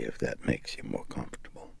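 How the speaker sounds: fast sneering whisper. Acted. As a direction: breathe it out mockingly. Demon sneers.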